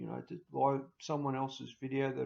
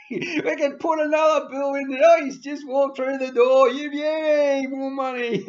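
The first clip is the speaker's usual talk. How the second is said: much higher and louder, crowing with glee; gleeful greedy advisers; 10 semitones higher, 12 dB louder than usual. Retold or imitated. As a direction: whoop like a greedy adviser spotting a mark. Mocking advisers celebrating a client.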